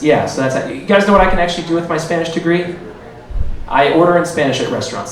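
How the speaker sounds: normal talking voice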